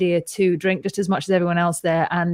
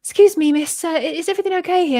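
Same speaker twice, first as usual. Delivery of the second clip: high-pitched attendant checking in; flight attendant; 9 semitones higher than usual. Retold or imitated. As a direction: put on a higher voice as concerned cabin crew. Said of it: "the flight attendant comes over".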